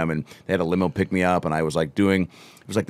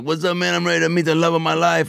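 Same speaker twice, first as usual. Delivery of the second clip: eager bachelor voice; 10 semitones higher than usual. Retold or imitated. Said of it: "I kept, like, playing with voices".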